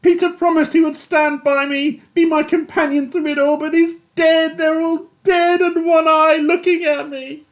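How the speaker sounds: high, loud tearful anguish; a broken man begging to go home; weeping despair. A narrator's reading. Yates weeping confession.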